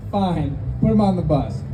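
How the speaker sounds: ordinary talk, nothing marked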